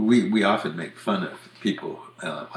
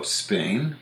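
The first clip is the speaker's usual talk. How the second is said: ordinary talk, nothing marked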